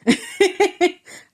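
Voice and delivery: a high, sheepish laugh to hold back tears; embarrassed laugh. Real, not acted. Fighting tears while telling viewers the vision, sheepish laugh. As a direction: laugh off the welling emotion. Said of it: "I'm not gonna get emotional".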